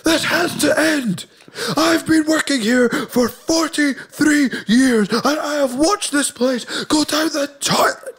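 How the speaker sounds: put-on high, slow, fed-up old worker voice; squeaky old-timer protesting at a slow pace; old factory worker. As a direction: play a weary veteran worker who has had enough. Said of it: "one person just stands up in the factory and is like".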